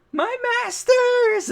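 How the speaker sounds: very high, slow, loud overjoyed cry; squealing with joy at the masters. Acted. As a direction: call out to your heroes in pure delight. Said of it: "Usopp's happy".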